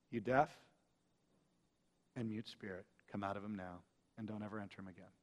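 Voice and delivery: hushed, very soft command; whispered command. Shown as a demonstration. Preacher imagining Jesus whispering the rebuke, hushed command. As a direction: breathe the rebuke almost silently. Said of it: "wouldn't you like it if Jesus whispered that".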